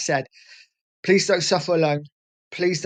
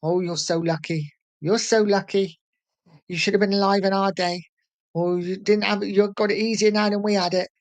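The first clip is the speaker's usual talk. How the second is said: lightly voiced nagging parent, higher pitch; a higher, mock-parent lilt; imitating parents; 5 semitones higher than usual. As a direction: mimic a parent telling the kids they have it easy. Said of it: "all our parents have said it to us".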